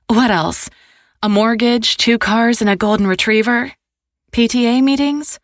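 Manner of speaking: playful mock-incredulous list; teasing excitement. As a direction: rattle off the cliché life, amused. Scarlet teasing about picket fence.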